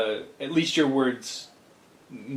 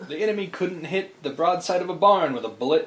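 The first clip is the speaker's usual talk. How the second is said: a lightly voiced cocky general, higher and louder; boastful general's last words; 7 semitones higher, 7 dB louder than usual. Retelling a general's doomed boast to a friend, mock cocky. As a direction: scoff at the enemy with misplaced confidence. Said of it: "Civil War general who died after saying".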